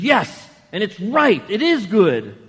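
loud, slow, stubborn insistence; loud, drawn-out mock-indignant Jonah; Jonah insisting. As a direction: dig in and insist you're right. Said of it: "He says".

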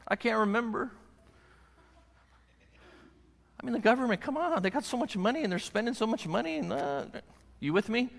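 high put-on silly voice; funny voice. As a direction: slip into a goofy mock character. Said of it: "I don't know why I'm making that voice".

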